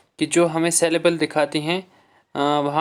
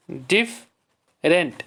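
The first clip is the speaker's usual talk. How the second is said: "Different" slow, split as डिफ, rent; syllable by syllable; much slower than usual. Shown as a demonstration. Teacher showing two syllables to the class, syllable by syllable. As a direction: leave a clear gap inside "different". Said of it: "इसमें भी दो syllable हैं".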